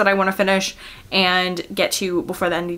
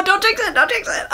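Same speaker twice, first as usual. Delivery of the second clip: playful jinx warning; 12 semitones higher than usual. Real, not acted. Superstitious aside.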